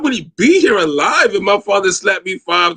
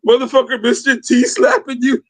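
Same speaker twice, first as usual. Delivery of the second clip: ordinary delivery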